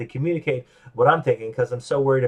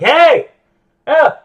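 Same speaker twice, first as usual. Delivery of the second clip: sudden much higher, much louder "Hey! Yeah!"; fake excited greeting; 12 dB louder than usual. Pretending to a phone scammer, suddenly high and loud. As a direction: burst in with mock delight, high and loud. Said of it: "At which point you're like".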